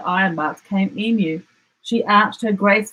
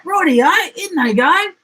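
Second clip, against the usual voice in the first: gleeful dingo; 11 semitones higher, 5 dB louder than usual. A narrator's reading. Dingo agreeing, high.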